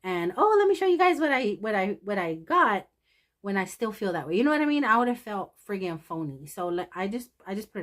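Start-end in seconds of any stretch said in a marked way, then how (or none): 0.4-2.9 high, bright, loud mock cheer; hammed-up fake enthusiasm; fake-cheery vlogger. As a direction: put on a phony happy haul intro. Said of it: "I can't get in front of this little camera".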